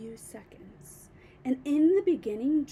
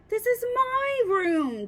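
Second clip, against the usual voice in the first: put-on indignant boy; 8 semitones higher, 5 dB louder, slower than usual. A narrator's reading. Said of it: "George said".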